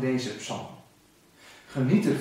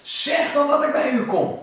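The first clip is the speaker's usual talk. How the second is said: calling out; 10 semitones higher, 5 dB louder than usual. Acted.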